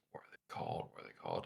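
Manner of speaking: unvoiced whisper to herself. Real, not acted. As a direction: whisper the question under the breath. Searching for a word mid-chat.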